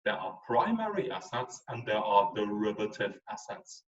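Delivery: ordinary talk, nothing marked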